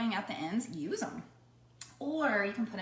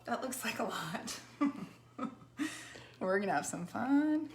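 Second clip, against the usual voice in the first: ordinary delivery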